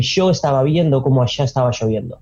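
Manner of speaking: fast porteño accent, "Yo" and "lloviendo" with a strong sh sound; Buenos Aires porteño accent. Shown as a demonstration. Imitating a Buenos Aires speaker for a friend, porteño accent. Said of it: "someone from Buenos Aires will be".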